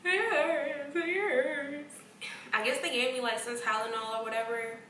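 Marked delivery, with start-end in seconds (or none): 0.0-1.9 high, whiny moan of pain; sing-song whimper. Retold or imitated. Patient waking from surgery, whining in pain. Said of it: "I was in pain".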